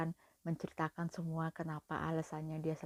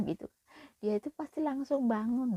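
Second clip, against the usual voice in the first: ordinary delivery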